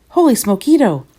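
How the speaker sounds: high, loud burst of amazement; exclaiming. A narrator's reading. Kitten spots a doggy in the mirror, exclaiming. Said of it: "exclaimed Skippy John Jones".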